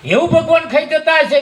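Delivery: you can't be serious; father's disbelief. Retold or imitated. Father doubts god really ate, high incredulous question.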